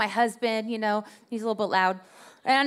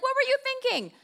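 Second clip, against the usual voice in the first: much higher, mocking question; mock exasperated husband; 12 semitones higher than usual. Husband reacting to the dented bumper, in a higher, mocking voice. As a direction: needle her about the bumper.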